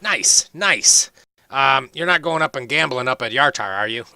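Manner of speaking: high, loud, eager dwarf voice; chatty young dwarf. Acted. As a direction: bounce in as the cheerful young dwarf. Hori voiced throughout.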